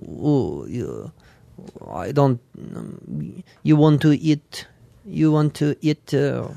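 soft, low, slow Russian accent; hushed, hesitant Russian that sounds French. Shown as a demonstration. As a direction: murmur haltingly in fake Russian-accented English. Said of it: "Russian goes".

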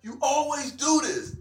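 high, whiny mock outrage; mock snapping. Acting out Becky snapping at rival, mock outrage. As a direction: complain high in pretend fury. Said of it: "I want Becky to fucking snap".

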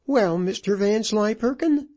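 high-pitched widow affecting indifference; feigned indifference. A narrator's reading. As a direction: put on a high, indifferent air. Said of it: "Assuming an indifferent air".

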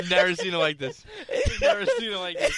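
high squeaky laughing voice. Real, not acted. Listener laughing hard.

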